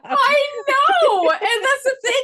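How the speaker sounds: high, loud laughing squeal; real high-pitched comic exasperation; exasperated protest. Real, not acted. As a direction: protest loudly at the teasing, pitch way up. Exasperated protest at being teased.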